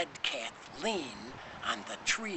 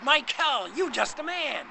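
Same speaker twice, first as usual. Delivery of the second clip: high, loud exclamation; crying out; 9 semitones higher, 6 dB louder than usual. A narrator's reading. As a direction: cry it out, pitched well up. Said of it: "Kathy cried".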